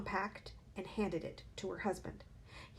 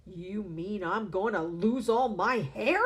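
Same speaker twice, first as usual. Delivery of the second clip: slow, louder panicked yell; 7 dB louder, slower than usual. A narrator's reading. Husband yelling at his wife in panic, slow and loud. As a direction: panic about losing hair. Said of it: "the husband yelled".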